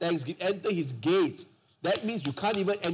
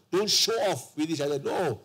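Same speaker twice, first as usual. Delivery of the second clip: ordinary talk, nothing marked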